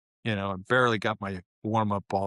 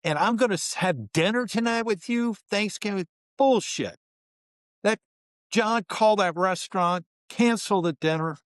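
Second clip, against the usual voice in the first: furious coach; 8 semitones higher than usual. Retold or imitated. Coach cancelling Thanksgiving dinner for the team, raging. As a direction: vent full fury at the team. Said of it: "He was so mad".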